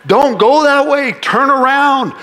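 high, loud pleading shout; booming prophet's cry to stop; God screaming. Retold or imitated. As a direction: yell a warning to turn back. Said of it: "his voice gets louder and louder".

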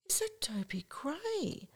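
hushed, high, slow disbelief; excited whisper. Retold or imitated. Lou spotting his idol across the club, excited whisper. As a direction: whisper it slowly, awestruck by the sighting. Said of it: "He got all excited".

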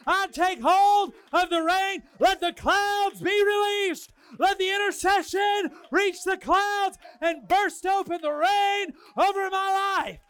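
shouted, high prophetic preaching; full-force preaching. Real, not acted. Pastor to congregation, loud prophetic preaching. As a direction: preach it with rising fire.

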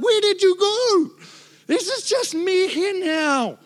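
high, slow plaintive cry; mock despair. Preacher acting his abandoned cry to God, high and slow. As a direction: sound abandoned and alone.

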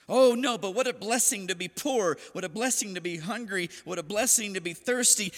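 hammed-up blissful enthusiasm; mock cheerful. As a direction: rave about hardship as if it were a treat. Mock-cheerful Jesus praising hardship.

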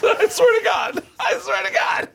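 very high, loud squeal through laughter; laughing insistence. Real, not acted. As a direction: shout it while cracking up. Laughing, insisting unbelievable story true.

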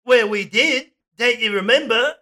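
put-on Aussie scammer; hammed-up Australian, coaxing an old mate; Australian accent. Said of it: "Oh, wait, we're Australian".

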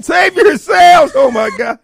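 high, loud panicked yelling; panicked shouting. Pretend. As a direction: scream in hammed-up panic. Mock panic, cat escapes.

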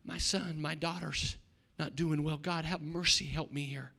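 soft, subdued plea to God; acted low-volume cry for mercy; pleading prayer. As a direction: pray it quietly, as if burdened. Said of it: "They cried out. They said, God".

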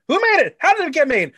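high, loud burst of playful indignation; mock outrage. Host playing conspiracy theorist over who made the dress, mock outraged.

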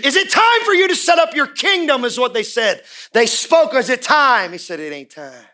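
high, loud preaching that builds with repetition; full-force preaching. Acted. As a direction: hammer the repeated lines home.

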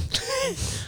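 sudden high squeaky laugh. Real, not acted. Laughs at own slip.

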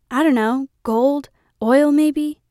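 a father dreaming of treasure; hopeful dad. A narrator's reading. Dad muses to daughter about gold, slow hopeful voice.

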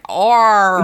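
AURA collapsed into one loud "R"; "R", a single drawn-out growl of R sound; hard American R. Shown as a demonstration. Host mocking how they say aura, hard American R. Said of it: "because I always say, like".

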